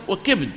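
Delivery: وكبد as "wa-kibd"; short i after k, none after b in وكبد; kasra then sukun. Shown as a demonstration. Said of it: "بكسر الكاف مع سكون الباء".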